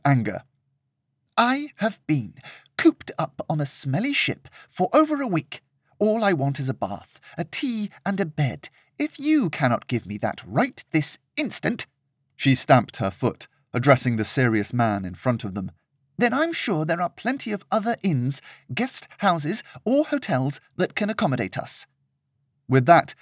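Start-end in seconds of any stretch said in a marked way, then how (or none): 1.4-11.8 high, pitched-up outraged socialite; a pampered young lady complaining; spoiled indignant lady. Acted. Serena complaining to Peter about the lodgings, high and indignant. 16.2-21.8 high, pitched-up outraged socialite; a pampered young lady complaining; spoiled indignant lady. Acted. Serena complaining to Peter about the lodgings, high and indignant.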